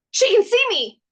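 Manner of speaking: high, loud thrilled squeal; excited squeal. Retold or imitated. Fae woman thrilled that she is seen, squealing with joy. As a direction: burst out like a delighted kid.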